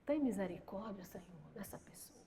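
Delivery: very soft, low plea fading into whisper; whispered prayer. Acted. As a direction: beg God under your breath. Demonstrates praying through clenched restraint.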